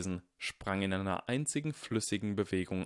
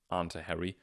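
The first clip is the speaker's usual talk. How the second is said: usual speaking voice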